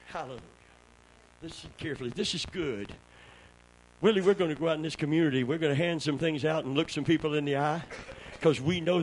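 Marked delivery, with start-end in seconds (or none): 0.1-2.9 much softer, leaning in; quiet confiding aside; hushed aside. Acted. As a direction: draw them close and hush. Drops voice to confide.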